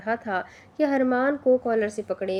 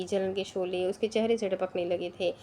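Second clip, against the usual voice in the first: ordinary delivery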